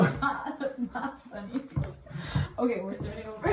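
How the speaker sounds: giggling through the words; can't stop laughing. Real, not acted. Giggle fit on camera.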